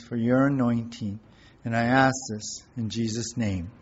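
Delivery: soft, earnest prayer voice. Real, not acted. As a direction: pray quietly and reverently to God. Pastor opens with spoken prayer.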